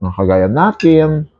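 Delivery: talking with food in mouth; speaking between bites; chewing while talking. Real, not acted. Seen 1: Eats with spoon throughout; lips closed, jaw working between words.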